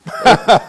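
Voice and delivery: loud amused laugh; laughing at the joke; hearty laugh. Real, not acted. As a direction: burst out laughing. Laughs at April Fool's date.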